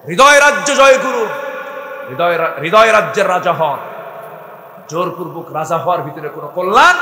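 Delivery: shouted, high sermon climax; preacher shouting his exhortation, high and loud; full-force preaching. Acted. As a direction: thunder it at the crowd.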